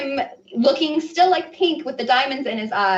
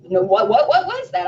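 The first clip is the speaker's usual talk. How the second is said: horrified; 4 dB louder than usual. Retold or imitated. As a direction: spill out the shock in a rush.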